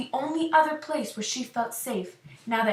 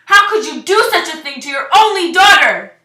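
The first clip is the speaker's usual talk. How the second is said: high, very loud accusing yell; yelling at the top of her lungs; 7 semitones higher, 15 dB louder than usual. Acted. Daughter confronting her parents over the spell, furious yell. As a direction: hurl it at your parents full force. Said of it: "yelling at her parents at the top of her lungs".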